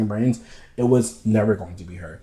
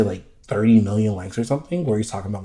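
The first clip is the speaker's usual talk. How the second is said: normal talking voice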